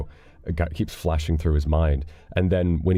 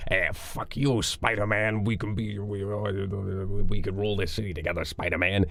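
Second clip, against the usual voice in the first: softer, put-on villain voice; Willem Dafoe; 3 semitones higher, 5 dB quieter than usual. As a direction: mimic a scheming movie villain, voice squeezed down. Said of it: "who does voices like that all the time".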